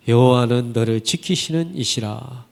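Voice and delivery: ordinary delivery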